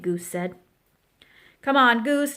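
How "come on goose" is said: louder, higher call; yelled urging. A narrator's reading. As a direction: call out to hurry a friend. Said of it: "Duck yelled".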